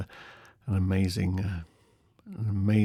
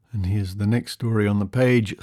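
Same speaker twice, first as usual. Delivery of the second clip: ordinary delivery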